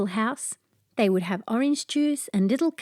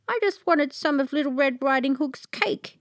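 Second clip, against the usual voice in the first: sorry dog; 6 semitones higher than usual. A narrator's reading. The guilty dog explains.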